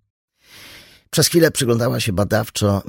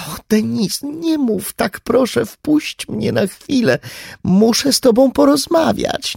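pleading mother; 4 dB louder than usual. Acted.

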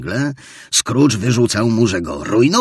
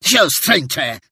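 stern interruption; 9 semitones higher, 4 dB louder than usual. Acted. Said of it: "przerwał surowo starzec".

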